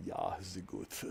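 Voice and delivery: very low, soft mock German voice; deep German dub voice. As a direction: imitate a deep German voice actor. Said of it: "trying to get that deep".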